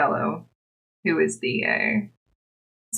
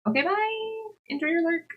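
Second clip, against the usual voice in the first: calling goodbye; 11 semitones higher than usual. Real, not acted. Streamer seeing off a lurking viewer, high sing-out goodbye.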